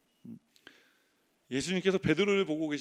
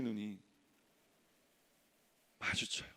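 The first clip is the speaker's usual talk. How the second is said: whispered, dropped to a hush; 6 semitones lower, 11 dB quieter than usual. Acted. Pastor telling of the eyes meeting, whispered. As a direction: let the word fall to a breath. Hushed climax of the story.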